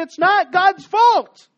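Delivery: slow, high, loud cry; preacher's shout. Acted. Preacher to Israel, a slow, loud shout. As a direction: belt it out slowly. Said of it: "the preacher's going up here and saying".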